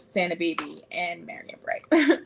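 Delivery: stunned whisper; hushed disbelief under the breath. Real, not acted. Stunned reaction to Christmas onesies.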